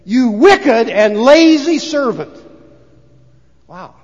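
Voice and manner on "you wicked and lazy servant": loud, very slow master's rebuke; stern rebuke. Retold or imitated. Master condemning the lazy servant, loud and slow. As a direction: spit out each word of the rebuke. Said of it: "The man says to him".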